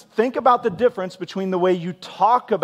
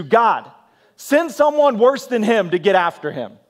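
acted-out loud, high plea; escalated angry prayer; 5 semitones higher, 6 dB louder than usual. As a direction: pray it like a heated demand to God. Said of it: "So we've escalated quickly here".

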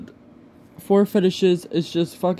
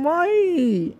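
anguished why; 12 semitones higher than usual. Real, not acted. Upset over fetish videos of him.

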